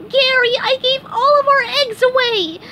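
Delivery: very high cartoon wail; SpongeBob crying. A narrator's reading. SpongeBob tells Gary the eggs are gone, mock crying. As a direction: lament it high, starting loud. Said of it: "SpongeBob went home and cried".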